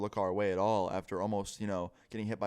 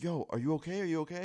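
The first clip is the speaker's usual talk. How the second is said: higher-pitched worried repeat, imitated; alarmed check-in; 7 semitones higher, faster than usual. Friend to the screaming stranger, retold alarm. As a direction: urgently ask after someone, lightly acted.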